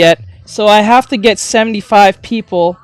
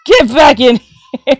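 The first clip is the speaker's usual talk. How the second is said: louder, higher, slow mock order to a tear; hammed-up raised-voice command; playful mock command; 5 semitones higher, 6 dB louder, slower than usual. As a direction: call the tear back, loudly and slowly.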